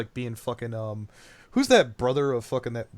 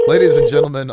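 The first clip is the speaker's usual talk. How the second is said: announcer voice; 12 dB louder, faster than usual. Acted. Announcing to stream audience.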